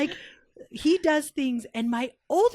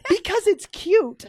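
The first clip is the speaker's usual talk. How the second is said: slow and higher, stating the obvious; slow matter-of-fact; 7 semitones higher, 6 dB louder, slower than usual. Retold or imitated. Kids telling puzzled friends why, slow and matter-of-fact. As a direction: drag it out like it's obvious.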